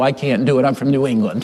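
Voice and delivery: low mock Scottish brogue, then dropping it; joking Scottish brogue. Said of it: "with this Scottish brogue".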